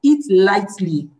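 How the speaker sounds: "lightly" stretched slowly and firmly; stress on lightly. Real, not acted. Teacher repeating fasting advice, slow emphasis on "lightly". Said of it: "Emphasis on the word lightly".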